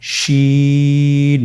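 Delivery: শিন held long and even; long held Arabic letter; drawn-out letter. Shown as a demonstration. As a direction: stretch শিন slowly for learners. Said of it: "সে হরফটির নাম হলো".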